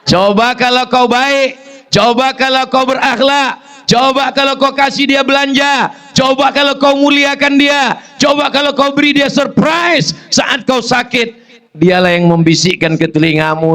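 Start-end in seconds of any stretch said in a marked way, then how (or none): 0.1-11.3 preaching at full force. Acted. Preacher reproaching husbands with a high, building list. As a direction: pile each clause higher.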